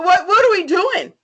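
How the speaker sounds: ordinary delivery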